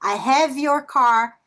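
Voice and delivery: slow demo, HAVE joined smoothly into "your"; linked vowels. Shown as a demonstration. Teacher showing students how words connect, linked and slow. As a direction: stretch "I have your car", running "have" into the next word. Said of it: "ele vai se conectar com a vogal".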